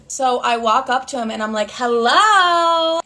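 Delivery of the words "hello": high, drawn-out hello; mock-cheery greeting. Greeting mom's boyfriend outside the restaurant, high drawn-out hello. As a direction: sing it out high, a little louder.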